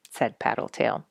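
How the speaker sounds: ordinary delivery, nothing marked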